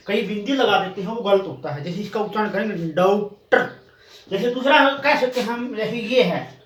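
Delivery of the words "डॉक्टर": डॉक्टर with a rounded open "daw", between daa and do; careful model pronunciation of डॉक्टर with a "daw" vowel. Shown as a demonstration. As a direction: say डॉक्टर as "dawk-tar", neither aa nor o. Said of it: "उच्चारण हम इन दोनों के बीच करेंगे".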